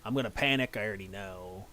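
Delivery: ordinary talk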